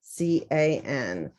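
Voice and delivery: slow letter-by-letter C A N; spelling aloud. Shown as a demonstration. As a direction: spell each letter slowly for writing. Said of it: "rewrite it, put it together".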